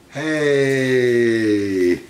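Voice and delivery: delighted yelp; surprised shout. Real, not acted. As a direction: burst out at the find. Surprise in hide and seek.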